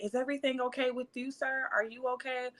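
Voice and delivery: soft, quick, fussing over a stranger; mom's fussing concern. Retold or imitated. Mom fussing over the kidnapper.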